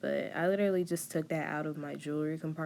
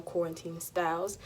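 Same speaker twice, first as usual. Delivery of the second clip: ordinary talk, nothing marked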